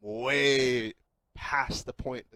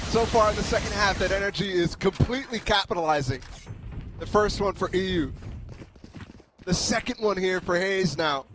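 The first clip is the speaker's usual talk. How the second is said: loud, higher excited analysis; esports caster; 8 semitones higher, 6 dB louder than usual. Acted. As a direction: ride the hype of the clutch. Analyst fired up after clutch.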